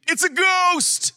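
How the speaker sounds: high, loud, drawn-out cry of alarm; frightened cry. Acted. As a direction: cry out in fear at a ghost. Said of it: "as they cried out for fear".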